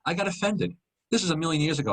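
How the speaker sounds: usual speaking voice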